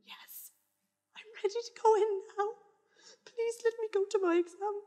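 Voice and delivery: high, soft shaky begging; quiet desperate plea; trembling plea. Retold or imitated. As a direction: beg meekly. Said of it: "And I was like".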